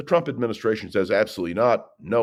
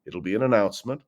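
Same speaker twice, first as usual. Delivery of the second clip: ordinary talk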